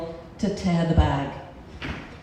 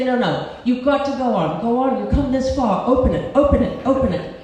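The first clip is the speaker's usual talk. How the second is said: higher, louder insistent pleading; urgent urging; 4 semitones higher, 5 dB louder than usual. Retold or imitated. Storyteller urges opening it after coming this far, urgent urging. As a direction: egg someone on, pressing and repetitive.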